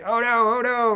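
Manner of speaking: high, loud mock panic. Imitating the robot mascot losing its arm, high mock panic. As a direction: squeal it like a cartoon in distress. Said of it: "the Roby the robot guy".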